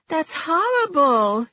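very high, wailing over her gnomes; a heartbroken collector finding the wreckage; distraught Judy. Acted.

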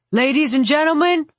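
high, projected showman's announcement; master of ceremonies. A narrator's reading. Mage addressing the bagpipers before the attack, high announcing voice. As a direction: announce it to the assembled performers.

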